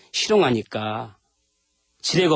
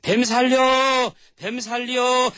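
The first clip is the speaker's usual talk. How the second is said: high, slow cry for help; calling for help; 10 semitones higher, much slower than usual. Acted. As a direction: yell it out drawn long and high. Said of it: "목청이 터지도록 ... 소리를 질렀어요".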